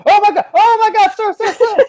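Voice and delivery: hammed-up frantic high-pitched yelp; mock panic. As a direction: squeal it like his ear is being blasted. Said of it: "be like".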